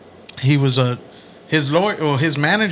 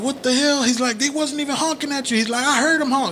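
high-pitched mock quarrel; high indignant argument; 9 semitones higher than usual. Friend acting out Larry and manager bickering over the honk. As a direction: play both sides of the squabble, high.

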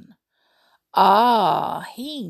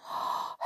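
soft sharp intake of breath; quiet scared gasp; frightened gasp; 12 dB quieter than usual. Acted. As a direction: gasp in fear. Hello was frightened.

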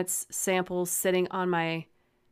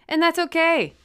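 mock cheerful; 10 semitones higher, 9 dB louder than usual. Real, not acted. Sudden high loud jump.

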